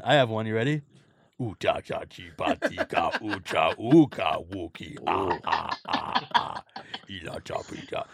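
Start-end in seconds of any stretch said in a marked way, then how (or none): 1.4-8.0 Jabba the Hutt. Acted. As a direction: speak huge-slug alien gibberish. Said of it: "Does anyone have a good Jabba impression?".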